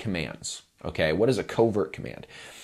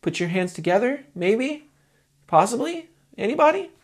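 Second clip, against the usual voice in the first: rising higher and higher, losing nerve; unsure, pleading. Shown as a demonstration. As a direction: beg the crowd, more doubtful each word. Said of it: "sounds like I'm asking a question".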